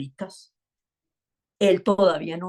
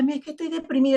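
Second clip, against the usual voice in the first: mock complaint; 8 semitones higher, much faster than usual. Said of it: "Hay gente que me dice".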